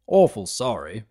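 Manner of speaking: lightly voiced simple stallion; short, simple farmhand apology; plain-spoken farm stallion. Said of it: "the large pony said simply".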